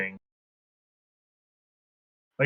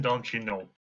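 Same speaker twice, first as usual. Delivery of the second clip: put-on Canadian accent; 3 semitones lower, 3 dB quieter than usual. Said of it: "that's just a stereotypical Canadian accent".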